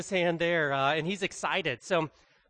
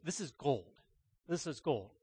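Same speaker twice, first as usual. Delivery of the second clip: soft, lowered, savouring voice; hushed reverence; 4 semitones lower, 10 dB quieter than usual. Retold or imitated. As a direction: imitate someone quietly treasuring a prize. Acts pastor treasuring the beef tongue.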